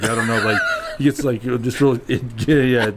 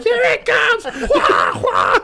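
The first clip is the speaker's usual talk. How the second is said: louder, laughing panicked shouts; laughing mock panic as the falcon dives; 3 dB louder, much slower than usual. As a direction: yell like diners ducking under tables. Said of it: "And they're like".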